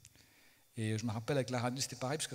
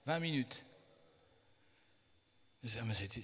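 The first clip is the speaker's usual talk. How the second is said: ordinary talk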